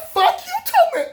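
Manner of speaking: very high-pitched mock yell; hammed-up outraged retort; retold angry comeback. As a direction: throw the curse back in a squeal. Acts out his cursing back.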